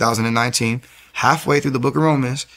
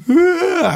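overwhelmed cry; 4 dB louder than usual. Retold or imitated. Overwhelmed, falls down.

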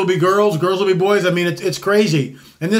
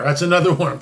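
ordinary talk

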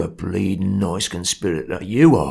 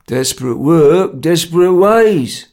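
slow, louder, terse verdict; laconic; 5 semitones higher, 6 dB louder, slower than usual. A narrator's reading. Ringleader answers the sobbing man's pleas, laconically. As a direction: drag out the saying, unmoved. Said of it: "Jerry replied laconically".

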